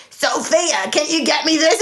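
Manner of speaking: mock fifty-year-old smoker voice; Denise. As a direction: rasp it out like an older smoker. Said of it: "a fifty-year-old smoker voice".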